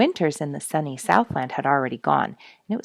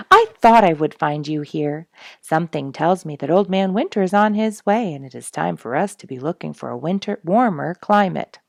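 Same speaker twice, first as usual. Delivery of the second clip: lightly voiced jolly Robin; 8 dB louder than usual. Robin Red voiced loudly.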